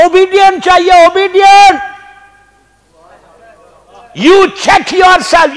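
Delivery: very slow, loud and commanding; stern shout. Real, not acted. Preacher challenging the majlis to look inward, slow loud shout. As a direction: hammer each word at the crowd.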